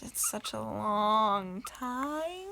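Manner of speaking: slow drawn out. Real, not acted. Slow exaggerated complaint.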